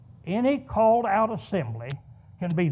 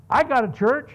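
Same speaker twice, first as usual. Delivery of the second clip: emphatic declaration; 5 semitones higher, 5 dB louder than usual. Real, not acted. Sermon punchline.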